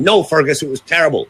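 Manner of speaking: mock-indignant girlfriend having a go; retold telling-off. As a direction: tell him off in a retold voice. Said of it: "she really had a go at me".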